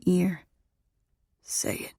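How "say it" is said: very soft coaxing whisper; intimate whisper. A narrator's reading. Lover with his lips brushed against her ear, whispering.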